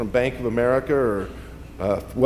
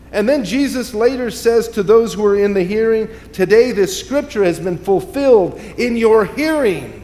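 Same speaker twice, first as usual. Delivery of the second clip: raised, impassioned preaching voice; 7 semitones higher, 5 dB louder than usual. Acted. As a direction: preach it out to the congregation. Preaching at full force.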